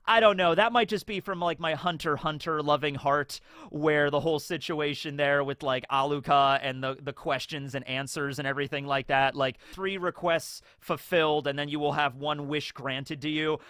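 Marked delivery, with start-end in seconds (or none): none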